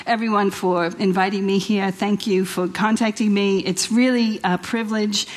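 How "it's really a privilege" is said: ordinary talk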